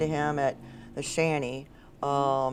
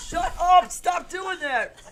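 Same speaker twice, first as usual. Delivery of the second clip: high, loud self-scolding, then drawn out; half-laughing high mock shout; mock scolding yell; 13 semitones higher, 6 dB louder, much slower than usual. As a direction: yell at yourself in pretend exasperation. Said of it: "I'm like".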